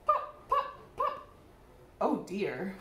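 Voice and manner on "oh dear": ordinary delivery, nothing marked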